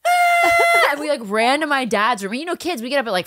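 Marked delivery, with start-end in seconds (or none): 0.1-0.9 very high loud shriek; high mock child shriek; child's shocked scream. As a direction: shriek in dismay. No presents shock.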